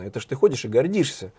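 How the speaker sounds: normal talking voice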